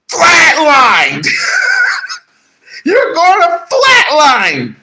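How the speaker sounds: shouting the flatline with a laugh; mock shout. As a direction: announce the flatline with glee.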